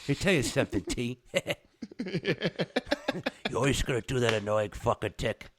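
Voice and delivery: lichte New Jersey maffiatoon; Jersey wiseguy, half-joking; Sopranos New Jersey accent. Retold or imitated. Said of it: "the very Sopranos, you'll not for nothing tone".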